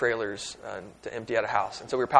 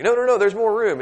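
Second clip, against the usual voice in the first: high, loud insistent dad; dad refusing to quit packing; dad insisting; 10 semitones higher, 8 dB louder than usual. Retold or imitated. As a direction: cheerfully overrule them as the dad. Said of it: "be like".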